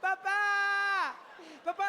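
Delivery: high, stretched wail for Papa; terrified French child. Retold or imitated. Frozen child calling his fleeing dad, mock terrified wail. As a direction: mimic a scared little French boy. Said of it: "little Jean-Pierre is planted to the spot, terrified".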